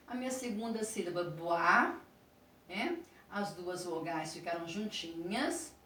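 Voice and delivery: ordinary talk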